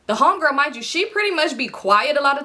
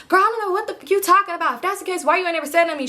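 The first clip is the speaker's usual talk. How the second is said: arguing; 6 semitones higher, faster than usual. Retold or imitated. Friends arguing about a boy.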